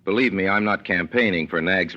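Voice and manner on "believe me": normal talking voice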